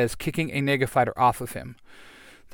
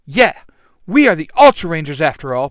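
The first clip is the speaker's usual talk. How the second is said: loud boast; 3 semitones higher, 9 dB louder than usual. Acted. Hero boasts to villain.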